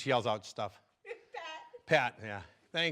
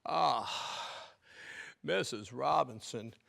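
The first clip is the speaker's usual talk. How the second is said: slow, drawn-out movie-scene delivery; Graduate scene impression; 3 semitones lower, 5 dB quieter, much slower than usual. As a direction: stretch the words out like a hesitant film character. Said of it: "in a famous scene in The Graduate".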